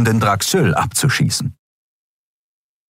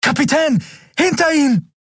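very high, urgent shouted warning. A narrator's reading. Miko warns Captain Nagun of the gunman, high shout. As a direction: yell to warn the captain.

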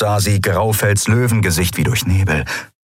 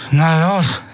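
higher, strained goading; numb-lipped defiance; 9 semitones higher than usual. A narrator's reading. Beaten Endriel goads her attacker, numb-lipped. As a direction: force it out past a numb lip. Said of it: "trotz der tauben Lippe".

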